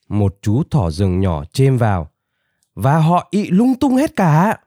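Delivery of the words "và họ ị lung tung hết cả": high-pitched small bunny voice; high little-creature complaint; little rabbit chiming in. A narrator's reading. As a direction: pipe up high, interrupting. Small young rabbit chimes in.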